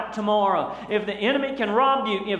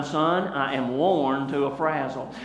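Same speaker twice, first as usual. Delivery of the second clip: put-on slow, lower, worn-out old granny; granny's weary voice; 7 semitones lower, slower than usual. Granny tells her son she's worn out, slow and weary. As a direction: sigh it out like a tired granny blaming her son. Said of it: "In that voice and with that face".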